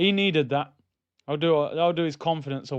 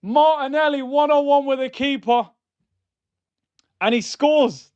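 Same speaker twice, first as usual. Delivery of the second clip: high, loud play-by-play call; hammed-up match commentary; mock commentator; 9 semitones higher, 5 dB louder than usual.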